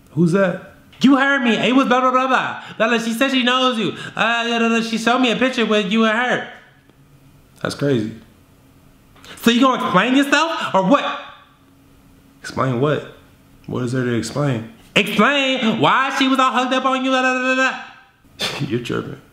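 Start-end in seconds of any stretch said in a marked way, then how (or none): 1.0-6.5 put-on accusing girlfriend. 14.9-18.6 high, nagging accusation; mock girlfriend demand. Girlfriend to boyfriend, high nagging demand.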